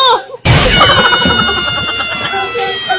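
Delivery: a high shriek of laughter breaks out; cracking up at the skit; high laughing shriek. Real, not acted. Laughing at the fake quarrel.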